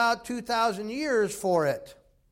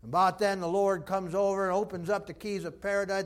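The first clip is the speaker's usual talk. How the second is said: normal talking voice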